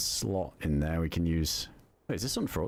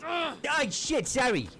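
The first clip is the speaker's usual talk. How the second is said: startled yelp; slower than usual. Real, not acted. Startled by another player.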